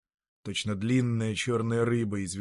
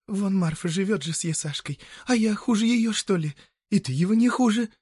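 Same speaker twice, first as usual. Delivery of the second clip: high-pitched woman arguing her case; a village woman pressing her lover, voice pitched well up; complaining peasant woman; 10 semitones higher, 4 dB louder than usual. Acted. Marya urging her lover by the river, high and insistent.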